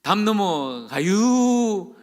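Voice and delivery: very slow, stretched Chungcheong dialect; slow Chungcheong drawl. Retold or imitated. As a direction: drag every syllable like a relaxed Chungcheong local. Imitating slow Chungcheong speech. (느긋하게 늘어지는 충청도 사투리)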